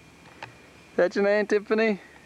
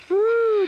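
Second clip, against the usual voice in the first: high, sustained "woo"; high whoop; 3 dB louder than usual. Real, not acted. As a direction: let out a high whoop of surprise. Reacts to balloon popping.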